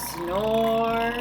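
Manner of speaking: usual speaking voice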